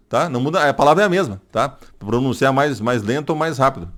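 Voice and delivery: usual speaking voice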